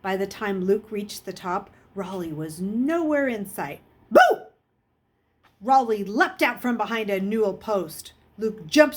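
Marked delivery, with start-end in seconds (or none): 4.1-4.5 very high, loud boo; scare shout. Acted. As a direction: leap out and yell it. Boy jumps out to scare.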